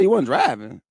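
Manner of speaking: mock surprise in a lifted voice; incredulous uncle. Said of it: "He said".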